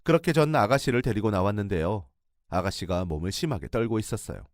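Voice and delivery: ordinary delivery, nothing marked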